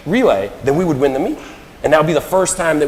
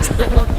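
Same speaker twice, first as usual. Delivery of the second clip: amused laugh; 11 semitones higher, 3 dB louder than usual. Real, not acted. Laughing at his own mishap.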